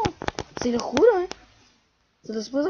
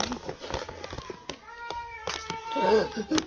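startled high gasp; sharp gasp; 11 semitones higher, 5 dB quieter than usual. Real, not acted. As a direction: gasp in alarm. Nearly dying in game.

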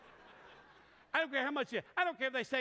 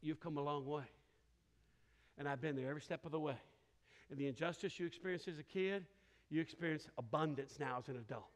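lower, softer, tender reassurance; reassuring Holy Spirit; 7 semitones lower, 5 dB quieter than usual. Retold or imitated. The Holy Spirit reassuring the preacher, low and tender. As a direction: comfort him like a gentle inner voice. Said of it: "And the Holy Spirit's like".

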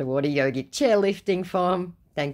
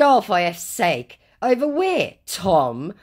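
exasperated; 7 semitones higher, 6 dB louder, slower than usual. Real, not acted. Narrator exasperated with scammer.

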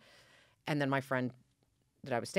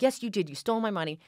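angry insistence; 7 semitones higher, 5 dB louder than usual. Retold or imitated. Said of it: "I'm like".

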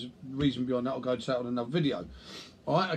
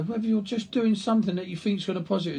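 normal talking voice

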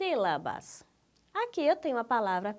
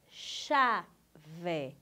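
"chave" in two separate beats, cha and ve, low; syllable division; 5 semitones lower than usual. Shown as a demonstration. Teacher showing digraphs are not separated, syllable division. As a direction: pause between the syllables, keeping "ch" as one sound. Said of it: "Não se separa os dígrafos".

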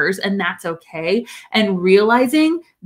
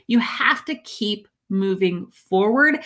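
ordinary talk, nothing marked

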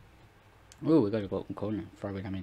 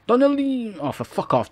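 much higher, much louder angry yell; furious shout; 14 semitones higher, 11 dB louder than usual. Real, not acted. Raging at his player for a miss, furious shout. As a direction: scream the player's name in frustration.

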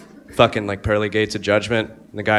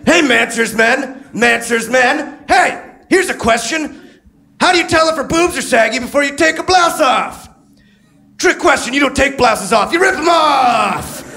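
monster truck ad; 5 dB louder than usual. Retold or imitated. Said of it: "that guy who does monster truck ads".

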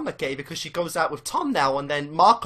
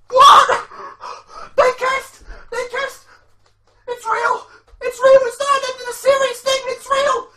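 high, loud, frantic fan screams, real shock; ecstatic screaming; 11 dB louder, slower than usual. Real, not acted. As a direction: shriek it over and over in disbelief.

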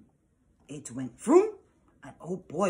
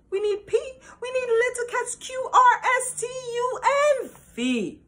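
rising excitement; 6 dB louder than usual. Acted. Cat excitedly calling helpers, building to a shrill peak. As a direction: voice climbs much higher and louder with each letter until it cracks.

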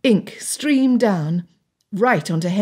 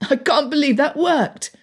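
high, delighted disbelief without real laughter; hint at laughter in a raised pitch; amused, laughing tone; 8 semitones higher than usual. A narrator's reading. Richard to friends after the prank worked, lightly amused.

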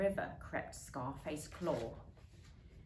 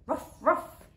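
high, loud ruff ruff; dog ruff; 9 semitones higher, 7 dB louder than usual. A narrator's reading. Muffin Maclay barks from her bathtub, high and loud. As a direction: give two gruff little barks. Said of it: "said Muffin Maclay".

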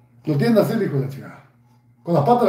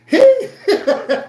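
mocking laugh; 6 dB louder than usual. Real, not acted. Laughs at his enemy.

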